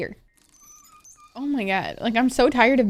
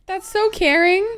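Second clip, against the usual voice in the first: loud gasp then high so caring; gasping delight; 5 semitones higher, 6 dB louder than usual. Real, not acted. Player gasping at a sweet scene, delighted. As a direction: gush in delighted surprise. Gasps at sweet game moment.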